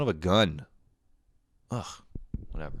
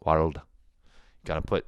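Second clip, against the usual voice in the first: ordinary delivery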